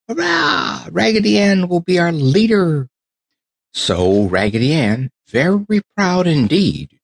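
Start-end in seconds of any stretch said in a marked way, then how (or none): 0.1-2.9 much higher cheer of a crowd; cheering shout. Acted. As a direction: cheer her as leader.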